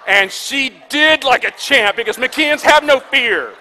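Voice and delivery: slow, booming, high-pitched victory cry; triumphant shout. Real, not acted. Preacher crowing about his brave daughter, triumphant shout. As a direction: shout it out like a champion's proud father.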